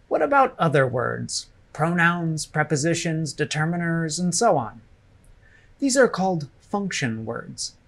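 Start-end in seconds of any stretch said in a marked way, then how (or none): none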